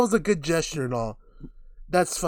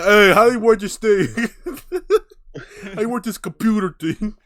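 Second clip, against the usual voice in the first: hammed-up confused, louder and higher; goofy clueless voice; 6 semitones higher, 8 dB louder than usual. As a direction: play a lost guy fumbling a computer. [name] as clueless GoFundMe typer.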